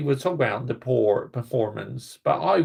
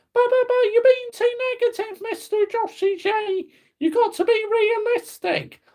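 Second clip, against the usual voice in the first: squeaky high-pitched mock heckler voice, stammering but but but; whiny critic. Imaginary viewer scolds Joshy for negativity, in a squeaky mock voice. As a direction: play a nagging fan scolding him in a silly high voice.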